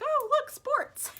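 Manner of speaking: very high, loud retold delight; mock excitement. Fan retells spotting golf on TV, squealing excitement. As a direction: squeal it, overdoing the thrill. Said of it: "I even got excited".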